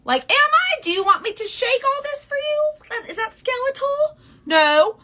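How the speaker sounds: very high, loud, incredulous comeback; mock indignant. Answering critics who call her skeletal, high-pitched mock outrage. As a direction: squeal the questions, then punch a loud no.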